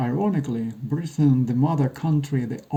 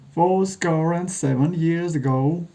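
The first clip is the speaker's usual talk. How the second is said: sharp, clear recitation; 4 semitones higher than usual. Acted. [name] recites Lincoln's address where it was given, sharp clear oratory. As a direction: open the speech as if to a crowd. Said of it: "[name]'s voice is sharp and clear".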